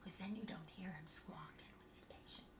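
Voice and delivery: fast conspiratorial whisper; whispered joke behind the puppet's back. Real, not acted. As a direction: share a secret with the viewers, under your breath. Aside to viewers about noisy puppet.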